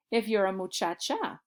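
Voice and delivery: normal talking voice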